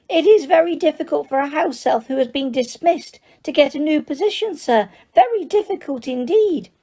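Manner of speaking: high, squeaky elf voice, eager to please; squeaky house elf. Acted. House elf tells Harry he needs work, high and squeaky. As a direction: pitch it up as an excitable little elf. Dobby voice held by reader.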